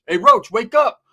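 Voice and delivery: loud, high holler at a sleeper; wake-up call. Retold or imitated. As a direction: shout to rouse him. Calling out to wake Roach.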